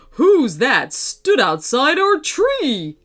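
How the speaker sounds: deliberate fatherly bat voice. A narrator's reading. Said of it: "asked Birdie".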